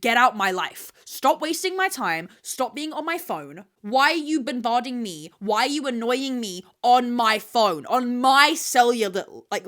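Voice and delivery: loud, furious telling-off; angry rant. Pretend. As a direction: rip into him at full volume. Ranting at pestering guys.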